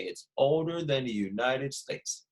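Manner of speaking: put-on older stretched slowly, softly; slow answer to repeat back; slow, model answer. Shown as a demonstration. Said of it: "just like that".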